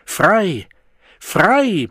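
laut und hoch gebrüllt; brüll es hinaus wie ein Befreier. A narrator's reading. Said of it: "brüllte der Wilde". (laut gebrüllt)

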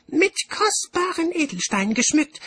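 very high, loud, excited character voice; high-pitched, exuberant official making a speech; cheerful bursar. A narrator's reading. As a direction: propose it gleefully in a high, piping voice. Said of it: "fuhr er fröhlich fort".

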